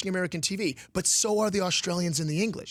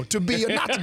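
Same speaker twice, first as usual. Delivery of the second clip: higher-pitched Detroit street take on Shakespeare; hammed-up Detroit accent; Detroit urban dialect; 4 semitones higher than usual. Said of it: "like, my cousins who live in Detroit".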